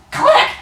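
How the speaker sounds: a very high, sharp mock "Click!"; camera click sound. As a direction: snap it out like a camera shutter. [name] imitates a camera.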